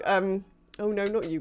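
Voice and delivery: ordinary delivery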